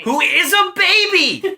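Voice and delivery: high, loud and slow through a laugh; laughing shout. Real, not acted. Echoing her friend's punchline with a loud laughing shriek. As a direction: shriek it back while cracking up.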